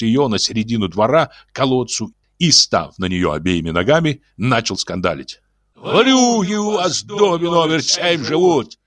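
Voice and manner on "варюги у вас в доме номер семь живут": high, hoarse-free yell in a put-on janitor voice. A narrator's reading. Janitor yells at the whole building, furious. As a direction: bawl it across the yard. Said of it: "вопил дворник".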